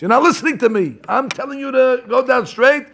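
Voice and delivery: put-on high, scolding the blinds like a disobedient child; hammed-up nurse telling off stubborn blinds; mock scolding nurse. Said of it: "That's what she's telling the blinds".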